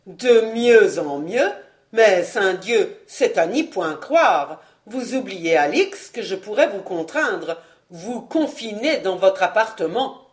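fort et lent, le père qui s'emporte; martelé, menaçant, plus fort; colère montante. Acted. Le père furieux menace sa fille. (le père menace sa fille de l'enfermer, avec une colère lente et forte)

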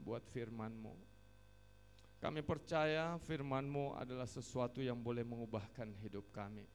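earnest prayer led aloud, loud openings falling away; congregational prayer. Real, not acted. Preacher prays to the Father for the congregation, earnest prayer.

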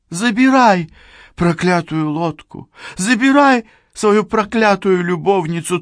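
high, desperate woman crying out; desperate surrender. A narrator's reading. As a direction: give up in anguish, loud. Cheryl gives in, desperate.

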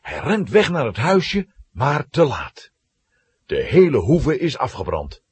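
ordinary talk, nothing marked

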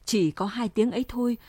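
usual speaking voice

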